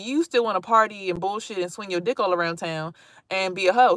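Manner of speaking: ordinary talk, nothing marked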